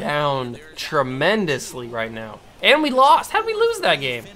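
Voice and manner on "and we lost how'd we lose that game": high, incredulous cry; can't believe it; shocked disbelief. Real, not acted. Gamer reacting to losing the game, high incredulous cry.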